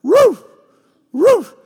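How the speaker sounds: sharp, high, loud woof; imitated dog bark. Dog at the couch needing to go out, high loud woofs. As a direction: yelp like a dog demanding attention. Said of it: "And she'll go".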